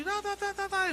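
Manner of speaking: high mock babble of tongues. Preacher mimics the woman's tongues again, high babble. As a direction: rattle off the syllables like tongues. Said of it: "en lenguas".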